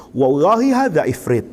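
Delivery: low imitated Egyptian Arabic; Egyptian Arabic quote. Egyptian listener reacting to the reciter, low imitated Egyptian Arabic. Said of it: "Dia ngomong, orang Mesir".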